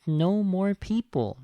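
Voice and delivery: playful impression of a toy man; mock Little Bear voice. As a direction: put on a light Little Bear voice.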